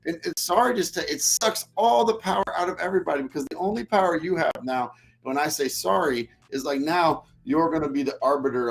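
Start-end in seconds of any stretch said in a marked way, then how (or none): none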